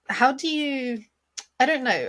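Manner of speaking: ordinary talk, nothing marked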